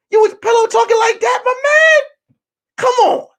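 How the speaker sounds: very high, loud screech of disbelief; outraged shout. Retold or imitated. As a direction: blow up at a friend, hammed-up. Repeats accusation, escalating to shout.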